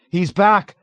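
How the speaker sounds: high, loud urgent shout. A narrator's reading. Someone answering the panicked patient, shouting. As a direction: shout the alarm at him. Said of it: "someone shouted at him".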